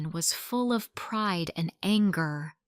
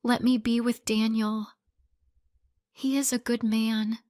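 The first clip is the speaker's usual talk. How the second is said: begging; 4 semitones higher, 3 dB louder than usual. A narrator's reading. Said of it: "she begged".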